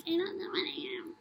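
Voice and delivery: high-pitched mimicry of someone clueless; put-on clueless whine; mock cluelessness. Mimicking viewers playing clueless.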